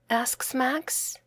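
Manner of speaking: usual speaking voice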